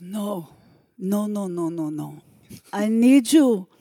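put-on loud, high Cuban director accent; hammed-up Cuban accent, shouting notes; terrible Cuban accent. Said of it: "I am about to do a terrible Cuban accent".

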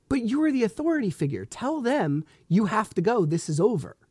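retold protest, pitched high and insistent; exasperated appeal. Frustrated dad urging police officer to act, exasperated. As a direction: press the officer to step in, high-voiced.

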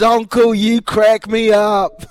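high, loud, slow amused fan line; drawn-out delighted fan voice; the fan calling him uncle, loud and delighted. Retold or imitated. Stranger laughing reply.

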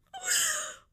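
sharp high gasp of delight; excited gasp. Real, not acted. Vlogger amazed at ChatGPT, sudden high gasp. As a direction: gasp out loud, thrilled. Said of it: "I'm getting excited".

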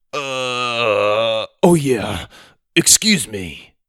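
long dopey 'uh', then recall; dim big kid. Acted. Bully searches his tiny brain for manners, dopey. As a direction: grope for the answer like a slow-witted bully.

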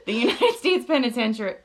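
loud giggly over-pronounced; laughing fit over the word; laughing, mangling it. Real, not acted. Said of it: "[name], get it together".

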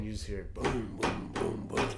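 normal talking voice

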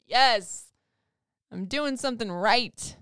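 proud mom cheering her son's sportsmanship. Retold or imitated. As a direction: celebrate under your breath, then cheer. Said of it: "I was like".

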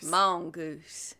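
low Southern drawl on mongoose; a lightly done Texan drawl; Matthew McConaughey drawl. Pretend. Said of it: "Matthew McConaughey".

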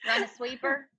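broad Pittsburgh Yinzer accent. Shown as a demonstration. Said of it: "I will give you an accent".

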